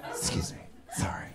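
breathy whisper of excuse me; whispered apology. Retold or imitated. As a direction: whisper sorry as you squeeze by. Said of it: "You just go".

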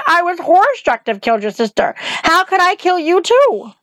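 put-on a child near tears begging; weeping girl. Dorothy pleads with the witch, weeping and high. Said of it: "said the girl, weeping".

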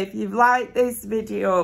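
ordinary talk, nothing marked

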